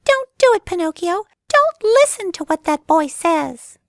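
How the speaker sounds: very high, loud tiny cricket; urgent cricket warning. Acted. Cricket warns Pinocchio.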